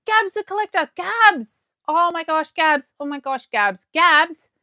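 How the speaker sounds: high, loud squealing over a gift; thrilled shrieks of a friend's name; excited squeals. Real, not acted. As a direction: squeal with delight at each name.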